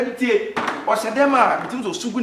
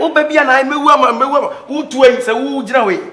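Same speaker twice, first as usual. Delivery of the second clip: higher and louder, hurt and furious; loud angry retort; 6 semitones higher, 8 dB louder than usual. Acted. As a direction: hurl it back at him in anger. Heated quarrel between the two.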